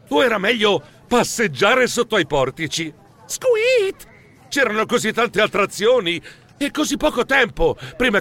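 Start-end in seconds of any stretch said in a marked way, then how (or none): 3.2-4.1 a tiny high mouse squeak. Acted. Startled mouse squeaks in the busy square, high squeak.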